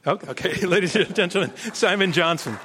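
ordinary talk, nothing marked

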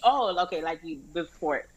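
ordinary talk, nothing marked

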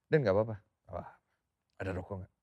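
low, soft forced composure; forced calm. Retold or imitated. Friend asks if he's okay, he answers in low forced calm. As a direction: keep it low to hide the shock. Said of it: "berusaha untuk teguh".

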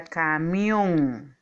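"camión" drawn out, with weight on "mión"; stress on last syllable. Shown as a demonstration. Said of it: "Su sílaba tónica es mión". ("camión" alargado, con fuerza en "mión")

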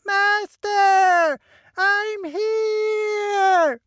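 high, whiny little servant calling; a reedy, pleading little voice calling out. A narrator's reading. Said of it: "I can just hear his whiny voice".

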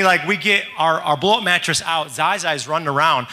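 usual speaking voice

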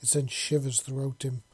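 ordinary delivery

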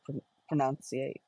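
ordinary delivery

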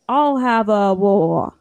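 mock mumbler, key words blurred and falling; imitated mumble. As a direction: repeat the order as a slurred mumble. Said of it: "he keeps mumbling the important part".

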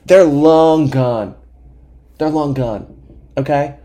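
high, loud mock scolding; hammed-up fed-up outburst; exasperated rant. Exasperated rant at Jim, retold.

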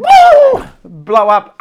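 wild overblown yelp; mock explosion. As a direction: burst like someone blowing up. People explode over small things.

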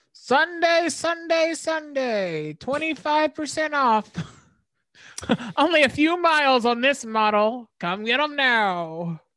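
loud, high car-lot ad announcer; car salesman pitch. Acted. Co-host parodies his sales pitch in a used blazer, loud salesman. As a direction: hawk it like a cheesy TV car dealer, drawing out the ends. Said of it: "he put on, like, a used blazer, and he was like".

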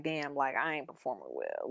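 low, hushed voice of a man owning up; humbled admission. Acted. Imitating a man not ashamed to admit failing, quiet humbled admission. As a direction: drop it low and soft, as a private confession.

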